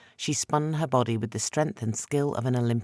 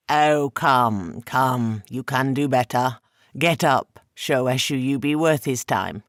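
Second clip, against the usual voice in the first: put-on louder, halting, overpronounced Sandawai accent, taunting; 5 dB louder, slower than usual. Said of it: "in the halting, overpronounced accent of the Sandawai".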